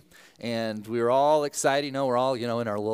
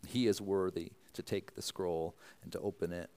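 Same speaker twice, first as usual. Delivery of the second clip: soft, hushed pastoral prayer; 3 semitones lower, 4 dB quieter than usual. Acted. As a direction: pray quietly and reverently. Opening prayer before sermon.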